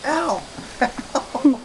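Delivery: ordinary delivery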